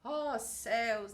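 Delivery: hammed-up lament, slow drawn-out words; mock self-pity, stretching each word out; over-dramatized woe. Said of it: "Dramatizar demais aquela emoção".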